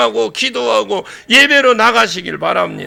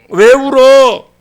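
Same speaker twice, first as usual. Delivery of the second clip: demonstrated angel's question; 8 dB louder, much slower than usual. Shown as a demonstration. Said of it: "내가 천사할 거야".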